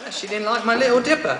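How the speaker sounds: high-pitched put-on British accent. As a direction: slip into a comic British voice. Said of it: "say that in a British accent".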